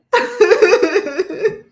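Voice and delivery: high loud burst of laughter; real delighted laugh; happy laugh. Real, not acted. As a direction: laugh at your own gushing. Laughs after gushing list.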